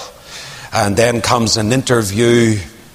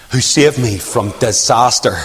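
ordinary talk

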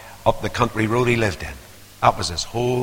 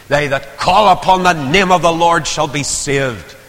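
scripture proclaimed; 6 semitones higher, 5 dB louder than usual. Acted. Quoting scripture.

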